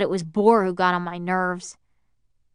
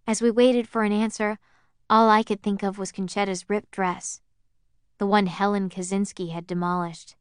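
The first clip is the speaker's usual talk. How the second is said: ordinary delivery, nothing marked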